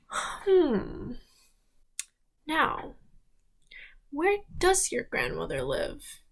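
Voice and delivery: a lightly voiced cunning wolf; sly wolf. Wolf asks about grandmother.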